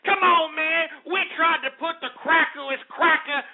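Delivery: high-pitched mocking quote of bystanders; imitated background brothers. Host imagining caller's friends complaining, mocking imitation. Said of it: "hear the brothers in the background saying".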